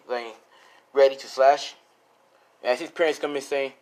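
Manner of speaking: ordinary delivery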